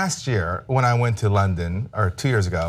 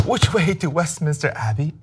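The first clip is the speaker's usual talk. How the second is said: higher-pitched, badly faked British accent; awful British accent; 5 semitones higher than usual. Shown as a demonstration. American tourist asking directions in London, awful British accent. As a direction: ask like an American faking British, lilting up. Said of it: "speaking in my awful British accent".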